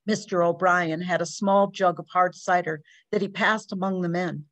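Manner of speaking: ordinary delivery, nothing marked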